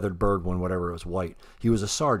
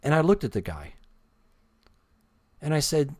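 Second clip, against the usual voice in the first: usual speaking voice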